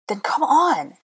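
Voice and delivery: high, urgent cry. A narrator's reading. Hermione yanking Draco's arm, urgent. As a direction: urge him to flee, voice leaping up.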